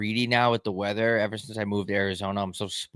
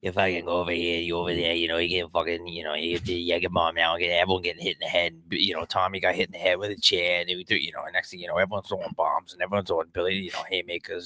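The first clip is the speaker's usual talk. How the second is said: Boston tough guy; 3 semitones lower, 3 dB quieter than usual. Pretend.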